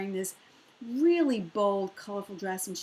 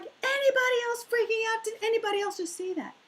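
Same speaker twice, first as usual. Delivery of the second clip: freaking out; 12 semitones higher, faster than usual. Retold or imitated. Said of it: "She's like".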